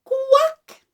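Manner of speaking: high quack, rising at the end; perky upward quack; duck quack. Shown as a demonstration. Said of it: "Watch my mouth".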